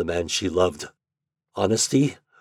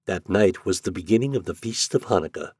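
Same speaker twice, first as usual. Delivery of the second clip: usual speaking voice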